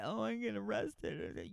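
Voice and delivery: whiny mocking imitation, quiet and slow; mocking whimper; mock upset mother. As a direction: mimic her self-pitying whine. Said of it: "look how she's all upset".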